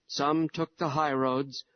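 ordinary delivery

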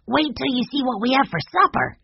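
put-on high, eager boy voice; young Benny. A narrator's reading. Benny teasing Peter about supper, high and eager. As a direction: lift into a keen little boy's voice.

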